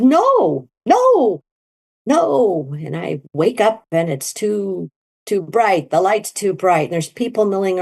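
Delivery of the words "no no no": panicked no. Retold or imitated. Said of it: "I was panicked".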